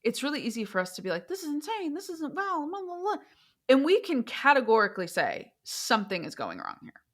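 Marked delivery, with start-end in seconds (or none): 1.3-3.2 high, soft, sing-song mimicry of onlookers; mock dismissive. Outsiders looking in on the case, mock dismissive. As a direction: imitate glib judgment in a light, high voice.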